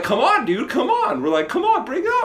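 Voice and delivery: very high, laughing come on; mock taunt. Taunting the man to fight.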